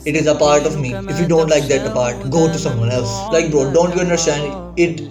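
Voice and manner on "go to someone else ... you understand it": ordinary talk, nothing marked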